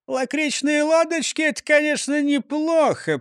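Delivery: high mayor voice, mumbled; musing mayor; mayor muttering. A narrator's reading. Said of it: "пробормотал бургомистр".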